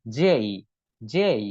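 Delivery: "যেই" repeated with a drawn-out ei glide; long J glide. Shown as a demonstration. Teacher drilling the letter J, "যেই" with a full ei glide. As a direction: stretch "যেই" and slide into ই. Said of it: "এইটা উচ্চারণ হবে".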